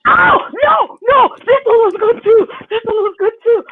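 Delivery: high, loud excited shriek; shrieking jackpot winner. Real, not acted. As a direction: squeal over a find. Excited at old season photos.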